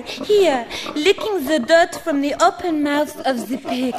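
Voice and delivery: matter-of-fact French demands; French accent. Acted. Claudette orders Stuart into the pigsty, breezy French accent. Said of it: "in a French accent".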